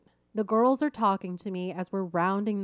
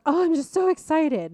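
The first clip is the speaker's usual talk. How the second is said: put-on higher, louder bubbly teen gush; gushing excitement; 8 semitones higher, 7 dB louder than usual. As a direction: mimic a bubbly girl who can't wait. Girl on coaster gushing.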